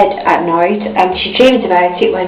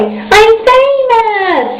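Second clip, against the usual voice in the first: much higher, drawn-out breathless gasp; 13 semitones higher, much slower than usual. A narrator's reading. Miss Star sees the shop window, a high slow gasp. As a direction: gasp it in delighted disbelief. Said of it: "she gasped".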